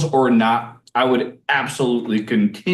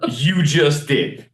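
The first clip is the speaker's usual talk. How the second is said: slow, deadpan snarky retort; mock-snide slow comeback; slower than usual. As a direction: imitate a snarky know-it-all, drawing out each word. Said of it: "he's normally pretty snarky".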